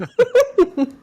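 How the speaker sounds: shrieking laugh; loud high laugh; high burst of laughter. Real, not acted.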